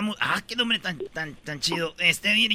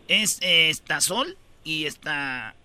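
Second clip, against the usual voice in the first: ordinary talk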